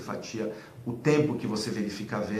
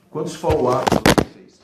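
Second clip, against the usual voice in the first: louder, animated question; carried-away excitement; 2 semitones higher, 8 dB louder than usual. Real, not acted. Manager questioning a seller about follow-ups, loud and carried away. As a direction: fire out the question with too much enthusiasm. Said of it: "desculpa, é a empolgação".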